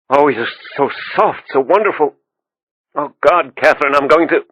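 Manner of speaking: passionate. A narrator's reading. Lovers climax in the scene.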